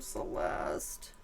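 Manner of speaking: unvoiced whispered name; whispered Celeste to the spirit. Real, not acted. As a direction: breathe the name, grieving.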